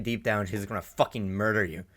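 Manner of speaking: ordinary talk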